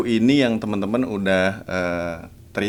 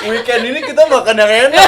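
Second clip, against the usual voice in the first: high, loud, laughing announcement; retold excited yell; 9 dB louder than usual. Daughter promising mom a weekend feast, retold excited yell. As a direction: shout the treat out through laughter. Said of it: "Sumpah, gue ngomong kayak gitu".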